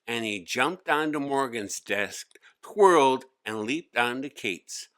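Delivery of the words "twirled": ordinary delivery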